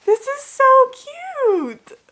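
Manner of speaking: very high, loud, slow squeal; squealing over cute. Real, not acted. Opening a gift from her friend, delighted squeal.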